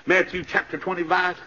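usual speaking voice

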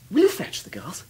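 ordinary talk

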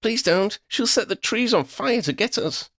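high-pitched anxious pleading; desperate high plea; begging. A narrator's reading. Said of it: "Lydia begged".

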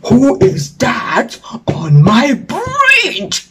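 slow, menacing crocodile voice; slow crocodile growl. Acted. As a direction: drag each word out like a sleepy beast. Crocodile wakes up.

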